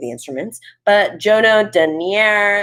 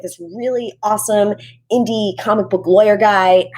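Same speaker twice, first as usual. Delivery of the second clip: ordinary talk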